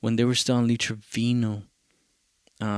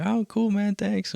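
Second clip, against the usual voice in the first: lightly voiced laid-back patron, higher pitch; imitated casual thanks; 10 semitones higher than usual. Bar patrons thanking him for free CDs, lightly imitated casual thanks. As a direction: toss off a high, casual thanks.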